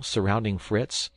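ordinary delivery

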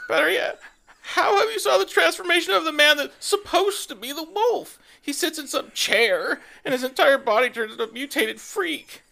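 put-on high, loud, laughing teen rant; outraged teen fan. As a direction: rant like an outraged teenage fangirl. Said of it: "teenage Twihard voice".

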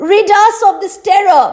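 high, imploring cry for help; urgent plea. A narrator's reading. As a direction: beg urgently, pitched well above narration. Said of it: "they urged, imploring the great magician".

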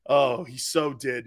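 usual speaking voice

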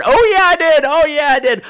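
high, loud taunting boast; defiant gloating. Acted. Comic flaunting banned words to objectors, defiant gloating.

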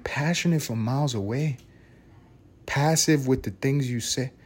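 moved to tears by the song, quietly reciting; tearful quoting. Retold or imitated. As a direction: murmur the lyric as if choking up. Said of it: "I start fucking crying".

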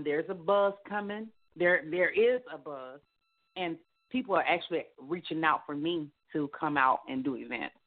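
normal talking voice

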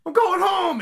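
very high, loud wail; whiny Yamcha. Retold or imitated. Yamcha running away after his tooth got chipped, high-pitched wail. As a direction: cry it out like a kid running home.

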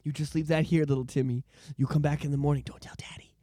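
low, soft teacher sharing a secret; conspiratorial hush. Acted. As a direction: hush a child, dropping toward a whisper. Acts teacher secretly hushing little Timmy.

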